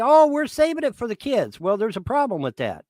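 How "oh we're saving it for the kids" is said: high, sing-song mock of their innocent excuse; parroting the family's excuse to the trolls, higher mocking sing-song. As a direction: sneer it in a prissy, much higher voice. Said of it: "They say".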